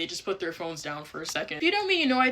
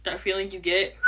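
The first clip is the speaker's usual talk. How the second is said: ordinary delivery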